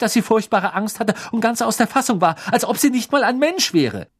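high, loud, indignant tirade; indignant outrage. A narrator's reading. Girl furious over the elf's treatment, outraged and loud. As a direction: let your outrage at the injustice pour out. Hermine outraged about the elf.

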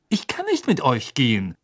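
ordinary delivery, nothing marked

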